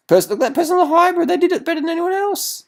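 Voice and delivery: high, fast squeal of delight; excited outburst. Real, not acted. Excited about hybrid rider.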